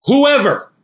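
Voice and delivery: "Whoever" cried out high and loud; stressed whoever. Shown as a demonstration. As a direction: ring out "Whoever" with awe. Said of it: "Who talks like that?".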